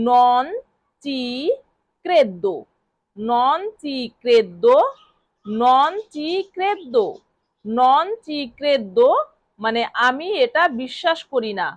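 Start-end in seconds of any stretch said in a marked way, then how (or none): 0.1-2.6 each word set apart by long pauses. Shown as a demonstration. Teacher breaking the phrase apart for Bengali students, paused word by word. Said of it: "এই বাক্যটিকে Italian ভাষায় বলতে হবে". 3.2-9.1 very slow, repeated for the class; slow drill. Shown as a demonstration. Teacher drilling a phrase for learners, very slow. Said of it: "এই বাক্যটিকে Italian ভাষায় বলতে হবে".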